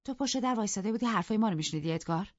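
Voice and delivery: brisk, goading accusation; provoking. Acted. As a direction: needle your husband, feigning nothing is wrong.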